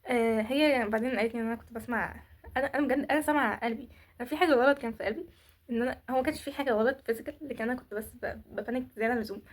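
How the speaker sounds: ordinary talk, nothing marked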